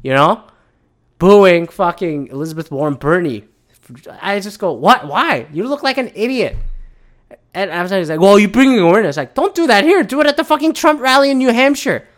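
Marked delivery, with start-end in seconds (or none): 4.7-6.6 baffled, pitch leaping up on the scolding; exasperated disbelief. Retold or imitated. Podcaster reacting to people booing at debate, exasperated. 8.2-12.1 high, loud indignant retort; exasperated argument. Retold or imitated. Podcaster telling protesters off for wrong venue, exasperated.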